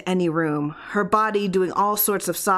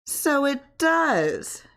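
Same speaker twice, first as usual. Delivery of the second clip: slow, wondering marvel; 8 semitones higher, much slower than usual. Acted. Wife discovering her kneecap moves, marveling slowly. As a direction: draw it out in amazement. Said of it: "she marveled".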